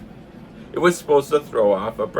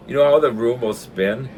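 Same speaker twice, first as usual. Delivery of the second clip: ordinary delivery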